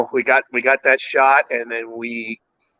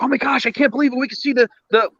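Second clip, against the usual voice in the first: much higher, excited mock gush; excited Dave; 12 semitones higher, faster than usual. Friend amazed at seeing the propellers, high excited gush. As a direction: squeal it like a thrilled friend. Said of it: "Dave's like".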